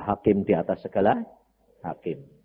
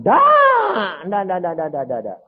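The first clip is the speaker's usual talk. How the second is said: emphatic no; 8 semitones higher, 9 dB louder, slower than usual. Retold or imitated. God answering no repeatedly.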